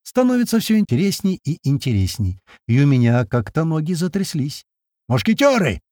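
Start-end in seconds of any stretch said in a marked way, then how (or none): 5.1-5.8 high-pitched rallying call; raised, carrying shout; yelling. A narrator's reading. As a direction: holler to summon the squad. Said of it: "это уже Крот орёт".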